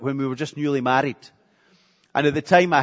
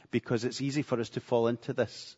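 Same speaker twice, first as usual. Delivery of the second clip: usual speaking voice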